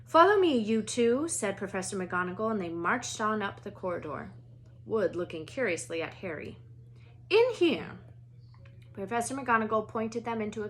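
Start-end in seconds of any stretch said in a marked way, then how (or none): 0.1-1.3 brisk, higher, stern Scottish teacher; clipped headmistress asking politely; a stern older Scottish witch at a classroom door; McGonagall voice. Acted. 7.3-8.0 brisk, higher, stern Scottish teacher; clipped headmistress asking politely; a stern older Scottish witch at a classroom door; McGonagall voice. Acted.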